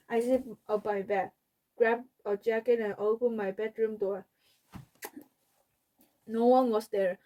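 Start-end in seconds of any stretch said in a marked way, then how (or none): none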